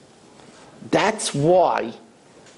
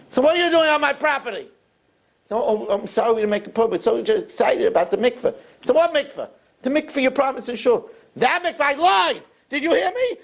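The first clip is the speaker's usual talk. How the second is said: higher, snapping at them to get off his land; angry rich man; 11 semitones higher, faster than usual. Acted. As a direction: drive them off the property. Rich man chases leaders away.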